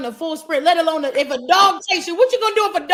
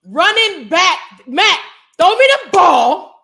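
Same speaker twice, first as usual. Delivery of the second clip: loud, slow call for the ball; loud stretched call to the quarterback; 2 semitones higher, 7 dB louder, slower than usual. Pretend. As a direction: shout it across the field. Calling out to quarterback Mac.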